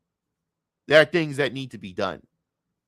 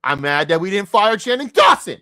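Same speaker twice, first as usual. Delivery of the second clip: rising, loud angry rant; real frustration, high and loud; mad outburst; 5 semitones higher, 8 dB louder than usual. Real, not acted. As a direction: build to a yell on the last name. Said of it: "I'm sorry, I'm still mad".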